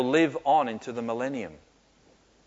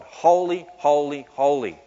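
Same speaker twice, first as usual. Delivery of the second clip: loud ringing proclamation of holy; each "holy" proclaimed louder and higher, falling on the last; proclaiming holy; 4 semitones higher, 8 dB louder than usual. Retold or imitated. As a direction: call it out like worship, much louder. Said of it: "like the holiness of God".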